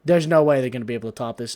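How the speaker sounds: loud, fast burst of disbelief; awed exclamation. Retold or imitated. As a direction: blurt it out fast and loud, overwhelmed. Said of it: "'cause I'm just like".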